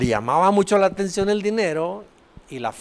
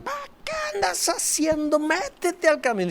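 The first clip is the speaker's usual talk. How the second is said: high exasperated scold at a stubborn donkey; much higher pitched, irritated rebuke; 11 semitones higher, 5 dB quieter than usual. Retold or imitated. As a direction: rider snapping at his donkey.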